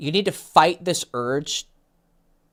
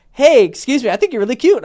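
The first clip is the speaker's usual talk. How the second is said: high, loud, expressive opener; with emotion; 7 semitones higher, 7 dB louder than usual. Shown as a demonstration. Coach showing client how to approach a girl, emotionally expressive. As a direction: sell the approach with real feeling. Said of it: "give me some emotion".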